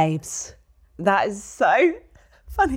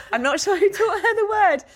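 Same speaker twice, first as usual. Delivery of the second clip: laughing; 9 semitones higher than usual. Real, not acted. Laughing through reading.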